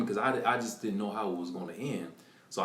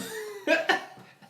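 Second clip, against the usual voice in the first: high breathless laugh; 3 dB louder than usual. Real, not acted. Laughing at the surprise.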